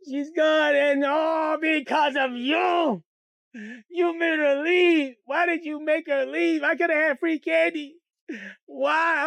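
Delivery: high, loud wailing complaint; tearful tantrum. Retold or imitated. Kid blaming sister for losing the candy, crying tantrum. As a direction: wail and blame your sister.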